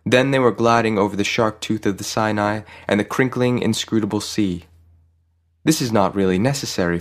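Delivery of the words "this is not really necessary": ordinary delivery, nothing marked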